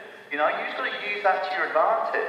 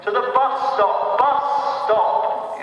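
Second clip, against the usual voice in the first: raised, chopped-up words for a foreigner; mock foreign-tourist English; 6 semitones higher, 4 dB louder, much slower than usual. Tourist shouting directions at a Greek, mock foreign accent. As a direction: hammer each word like a tourist. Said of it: "shout in English in a funny accent".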